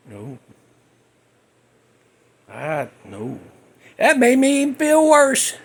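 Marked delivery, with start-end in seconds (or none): 0.1-3.4 much softer and lower, sheepish; ashamed no. Retold or imitated. Answering his mom about the pink eye, a low ashamed no. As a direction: mumble it like a caught kid.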